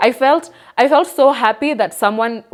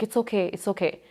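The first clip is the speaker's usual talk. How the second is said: very soft retold comforting; gentle reassurance; 10 dB quieter than usual. Cousin to the crying speaker, softly reassuring. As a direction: soothe someone softly, as if holding their hand.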